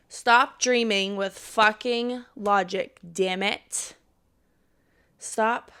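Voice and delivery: loud, slow, scolding yell; yelling at herself. Real, not acted. Vlogger yelling at herself to stop dreaming with logic, loud. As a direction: bark it like a self-lecture. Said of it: "I'm yelling at myself right now".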